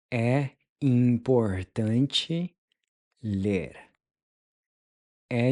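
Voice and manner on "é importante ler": "É importante" slowed, with a rising "ler" after it; slow model. Shown as a demonstration. Teacher modeling the phrase slowly for learners. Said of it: "How would you say it's important to read?".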